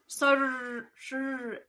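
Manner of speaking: "Sor-" broken off mid-try; failed R roll. Shown as a demonstration. Host attempting rolled R's, giving up. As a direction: stumble trying to trill the R. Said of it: "I can't do it".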